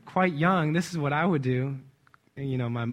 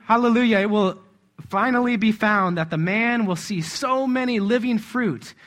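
loud, higher fervent reading; exultant preaching; 4 semitones higher, 4 dB louder than usual. Real, not acted. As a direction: proclaim it with rising fervour. Exults while reading the quotation.

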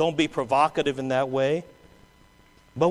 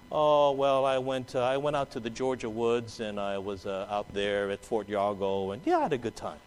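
sheepish dodge; 5 semitones lower, 8 dB quieter than usual. Acted.

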